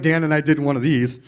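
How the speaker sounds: normal talking voice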